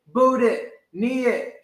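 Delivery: usual speaking voice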